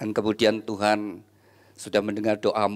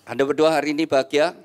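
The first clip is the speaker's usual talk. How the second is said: ordinary talk, nothing marked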